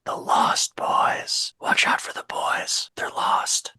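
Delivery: slow, eerie whispering; spooky whisper. Acted. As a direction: breathe it like a ghost story. Spooky whispered movie title.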